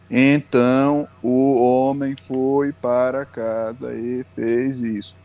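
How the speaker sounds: very slow, word-by-word drone; drawn-out, evenly plodding beginner reading; monotone halting reading. Shown as a demonstration. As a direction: read like a child decoding each word. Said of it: "a criança tá lendo assim".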